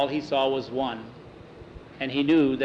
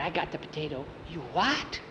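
softer, secret voice; hushed boasting; 8 dB quieter than usual. Acted. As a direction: brag quietly from inside the trunk. Boys whispering inside the trunk.

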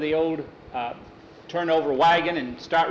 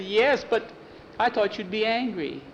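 put-on little girl; 6 semitones higher than usual. A narrator's reading. Girl to mother.

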